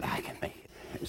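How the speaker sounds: breathless whisper to oneself; whispered reassurance. Retold or imitated. Soul bracing for birth, whispering to itself. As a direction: hush it, psyching yourself up.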